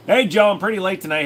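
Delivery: ordinary talk, nothing marked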